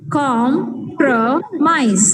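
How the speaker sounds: compromise drawn out very slowly, com-pro-mise; teacher modelling syllable count. Shown as a demonstration. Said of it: "three syllables".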